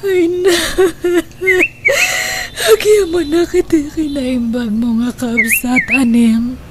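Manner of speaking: a touch of crying in the gratitude; tearful thanks. Acted. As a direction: voice catching in tears while thanking.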